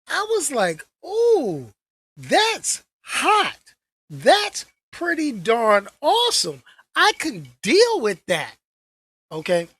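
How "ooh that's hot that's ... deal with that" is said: excited reaction. Retold or imitated. Reacting to the trailer.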